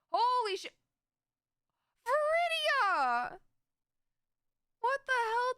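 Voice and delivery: high, drawn-out surprised cry; real shock, stretching the name; shocked shout. Real, not acted. Big gift just arrived.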